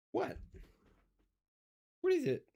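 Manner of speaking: high pet-talk question; talking to the cat. Real, not acted. Owner asking his cat in a high voice.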